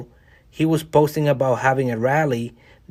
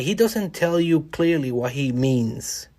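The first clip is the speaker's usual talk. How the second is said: normal talking voice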